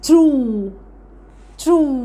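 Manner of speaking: "ترو" as a slow, stretched "troo"; double-O sound. Shown as a demonstration. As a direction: hold the "oo" at the end of "ترو". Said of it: "نطقتها برضو اتنين". (نطق "ترو" بواو طويلة)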